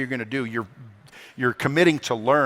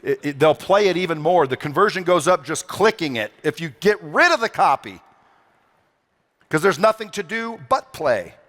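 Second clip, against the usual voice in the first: ordinary talk, nothing marked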